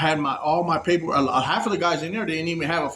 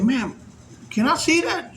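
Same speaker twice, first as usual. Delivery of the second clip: eager request; 8 semitones higher than usual. Retold or imitated. Asking girl to see phone.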